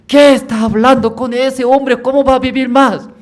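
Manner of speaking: high, loud, exasperated mother; mother's retort. Acted. Mother retorting to her son, high and loud. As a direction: snap back at your son.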